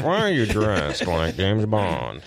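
imitating radio hosts. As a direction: drag the question out low, as an impression. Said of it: "Howard and Artie were like".

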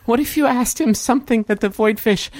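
put-on high child voice; earnest little boy. Boy detective offers the heroes an idea, high earnest voice. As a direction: pitch an idea as an eager kid.

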